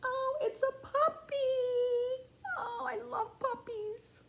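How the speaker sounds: high, slow, tender coo; gushing adoration. Pretend. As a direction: melt over the puppy like it's the cutest thing. Puppy reveal, gushing.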